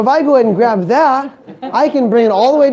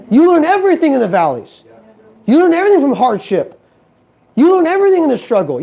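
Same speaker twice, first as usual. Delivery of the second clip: everything punched higher each time; stress on everything; 5 semitones higher than usual. Acted. Motivational speaker hammering the crowd on struggle, rising stress. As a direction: drive home everything like a preacher. Preaching repeated lines, building.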